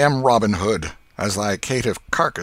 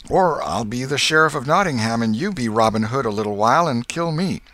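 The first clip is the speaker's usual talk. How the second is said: coaxing; 5 semitones higher, 5 dB louder than usual. A narrator's reading. Tom bargains.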